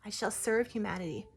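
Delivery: a gentle tree spirit softly offering help. Retold or imitated. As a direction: speak as the healing tree, hushed and reverent. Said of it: "what I heard in that parking lot".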